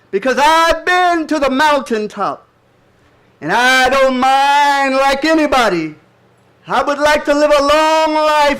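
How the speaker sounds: slow oratorical preaching, high and loud; Martin Luther King. Acted. Reciting King's mountaintop speech to an audience, impassioned loud oratory. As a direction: ring it out with long-held words and pauses. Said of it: "share a few words from Martin Luther King".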